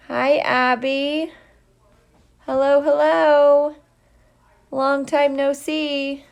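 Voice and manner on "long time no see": ordinary delivery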